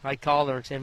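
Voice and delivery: ordinary delivery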